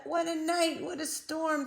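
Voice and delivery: mole muttering. A narrator's reading. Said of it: "Mole mutters".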